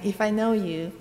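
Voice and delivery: ordinary talk, nothing marked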